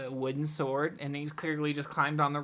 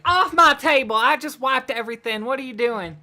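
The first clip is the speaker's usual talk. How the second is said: higher and much louder, scolding; angry bartender; 7 semitones higher, 10 dB louder than usual. Acted. Game master as a neurotic bartender yelling about the fish on the table. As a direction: snap at the customer who slapped fish down. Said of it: "turns around and is like".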